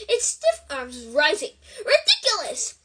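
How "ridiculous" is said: high, loud cry of the spell. A narrator's reading. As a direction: cry the word out firmly. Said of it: "cried Parvati".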